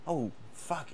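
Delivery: ordinary talk, nothing marked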